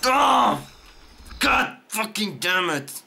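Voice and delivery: raging, exasperated outburst; real rage. Real, not acted. Gamer raging at the game in front of viewers, furious. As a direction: burst out in frustrated fury. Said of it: "sorry I'm raging".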